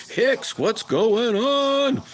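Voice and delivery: high, loud, slow drawn-out hello; excited greeting. Real, not acted. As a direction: stretch the greeting out to a buddy. Greeting a viewer on stream.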